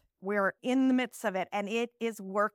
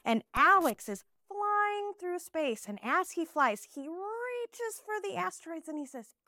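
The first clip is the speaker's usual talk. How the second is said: storytelling teacher; 8 semitones higher, slower than usual. Shown as a demonstration. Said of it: "teacher demo of card".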